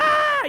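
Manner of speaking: high, loud mock scream; hammed-up panicked shriek; inner scream. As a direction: scream out the panic inside your head. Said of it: "I was just screaming inside".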